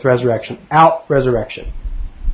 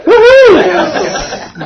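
high, loud drawn-out woohoo; playful whoop; 6 dB louder than usual. Real, not acted. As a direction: let out a big high-pitched cheer. Speaker whoops playfully amid laughter.

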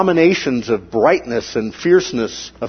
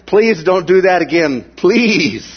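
mock pleading; 5 semitones higher, 4 dB louder than usual. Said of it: "threshold of my ear tolerance".

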